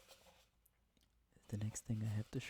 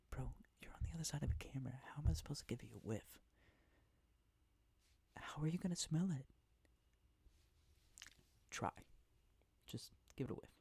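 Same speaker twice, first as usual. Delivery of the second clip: whispering; 4 semitones higher, faster than usual. Real, not acted. ASMR whisper video.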